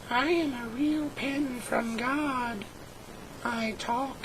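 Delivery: slow, soft, oddly distant pen voice; talking pen voice. Acted. A pen from God introducing itself, slow and soft. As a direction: play a magic pen introducing itself slowly. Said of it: "as proof of what I'm telling you".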